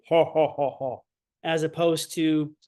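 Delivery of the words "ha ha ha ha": a hint of a Bowser laugh, slightly lower; lightly mock the big villain's chuckle. Shown as a demonstration. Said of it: "this is like Bowser laughing".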